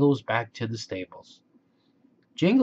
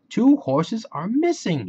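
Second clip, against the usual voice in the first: Slim Jim alarmed; 10 semitones higher, 3 dB louder than usual. Acted. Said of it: "he said".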